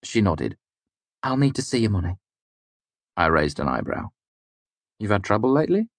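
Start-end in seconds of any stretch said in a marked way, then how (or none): 1.2-2.2 a hint of the barmaid, higher; lightly voiced tavern woman asking for coin. A narrator's reading. 5.0-5.9 higher, lightly voiced innkeeper woman; higher clipped tavern woman; barmaid voice. A narrator's reading. Narrator voices Kvothe's companion question.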